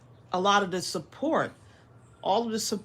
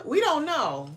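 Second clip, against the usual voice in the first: usual speaking voice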